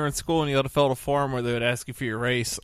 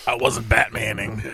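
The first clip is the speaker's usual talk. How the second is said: gruff Batman voice; 3 semitones lower, slower than usual. Retold or imitated. Impersonating Bruce Wayne excuse.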